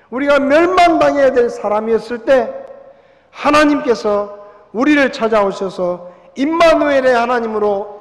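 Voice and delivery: loud, ringing preacher voice; preaching at full force. Acted. Pastor to his congregation, loud fervent preaching. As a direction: proclaim it to the whole hall.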